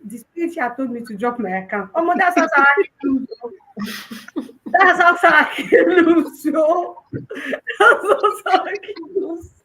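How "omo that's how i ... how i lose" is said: laughing through the punchline. Real, not acted. Laughing to her host about how she won. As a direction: let the laugh take over the words.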